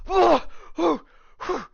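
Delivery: high, loud panicked yelps; panicked cries. Acted. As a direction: yelp in sudden panic. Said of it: "actually panicking for a second".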